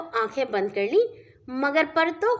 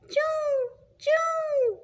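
chick cheeping; much slower than usual. Acted. Narrator mimics chick cheeping.

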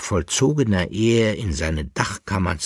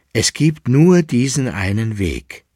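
firm, louder and higher declaration; 4 semitones higher, 6 dB louder than usual. A narrator's reading. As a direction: state it with full resolve. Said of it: "erklärte sie mit fester Stimme". (mit fester, entschlossener Stimme)

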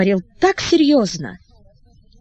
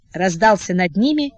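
usual speaking voice

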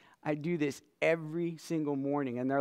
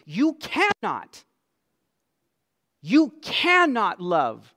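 slow, loud, hammered words; emphatic; 6 semitones higher, 11 dB louder, slower than usual. Real, not acted. Preacher insists to the congregation, slow and loud. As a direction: drive each word home. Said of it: "I am saying this with emphasis".

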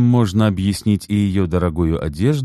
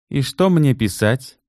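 slow, higher, ironic question; 8 semitones higher than usual. Acted. Natalya mocking the bankers' request, ironic. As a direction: let the irony drip, slowly. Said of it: "с иронией спросила".

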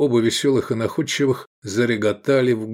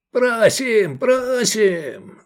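high, drawn-out jeering cheer; yelling; 12 semitones higher, much slower than usual. A narrator's reading. Drunk Ismat eggs on the show, yelling. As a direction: holler it high like a rowdy audience. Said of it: "заорал Исмат".

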